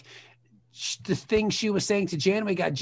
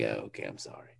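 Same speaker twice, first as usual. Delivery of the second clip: meek apology; 10 dB quieter than usual. Retold or imitated.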